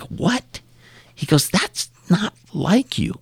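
imitating a shocked, high disbelieving voice. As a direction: squeak it out in surprise. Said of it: "And he was shocked".

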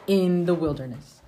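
steady, measured reading of scripture. Acted. Parent reading scripture to the family, measured and steady. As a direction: read it aloud to the family like scripture.

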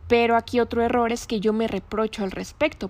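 usual speaking voice

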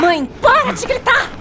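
high, loud desperate plea, slow; pleading shout. Acted. Daughter begging her screaming mother to stop, loud plea.

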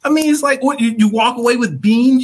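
high, loud disbelief; incredulous mocking. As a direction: scoff at him in disbelief. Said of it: "I'm like".

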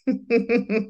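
high villainous cartoon cackle; cartoon laugh. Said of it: "the little cartoon laugh behind that".

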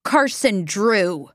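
name drawn out with mild contempt; slow, scornful naming; spitting out words. A narrator's reading. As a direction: spit the name out slowly. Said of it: "Eveline spit out the words".

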